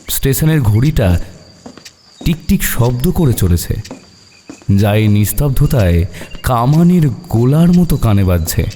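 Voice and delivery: ordinary delivery, nothing marked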